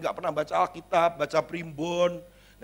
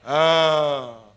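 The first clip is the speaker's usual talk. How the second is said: mock wail; 6 dB louder than usual. Mock wail before quote.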